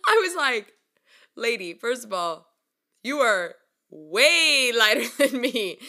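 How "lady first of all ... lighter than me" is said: sassy comeback. Retold or imitated. Retold comeback to the girl.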